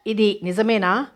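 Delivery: usual speaking voice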